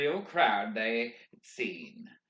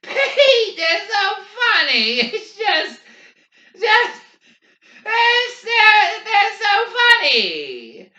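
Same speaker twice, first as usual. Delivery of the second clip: very high, loud hysterical wail; weeping with laughter; 12 dB louder, slower than usual. A narrator's reading. Garrity to Pete about the crowd, weeping with laughter. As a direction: gasp it out in broken bursts, squealing. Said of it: "nearly weeping with laughter now".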